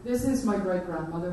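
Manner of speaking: usual speaking voice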